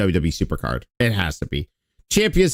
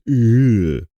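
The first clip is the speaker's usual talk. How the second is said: ordinary talk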